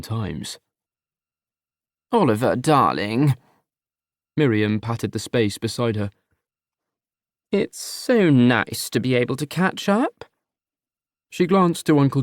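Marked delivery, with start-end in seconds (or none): 2.1-3.3 sugary mother's coo; a doting mother purring her son's name; Miriam's cloying voice. Acted. Mother greeting her son, sweetly cloying. 7.5-10.3 drawn-out, syrupy maternal voice; mother smothering with slow sweetness; slow sugary mother. Acted. Mother to her son on the sofa, slow and syrupy.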